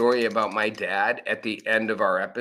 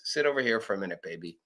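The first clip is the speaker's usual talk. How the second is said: gentle aside; 5 semitones lower, 7 dB quieter, faster than usual. Retold or imitated. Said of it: "pushes you to the side gently and says".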